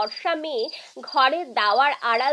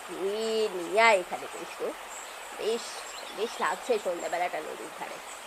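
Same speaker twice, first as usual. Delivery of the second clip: low, softer character voice; relaxed Radhamohan; 8 semitones lower, 8 dB quieter than usual. Acted. Radhamohan answering the neighbour's son by the river, low and relaxed.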